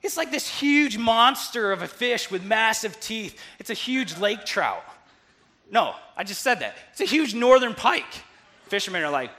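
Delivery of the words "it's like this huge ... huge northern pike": loud, high, stretched-out emphasis on the monster; big amazed storyteller's energy; excited storytelling. Real, not acted. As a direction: build to a booming reveal. Excited climax of fish battle.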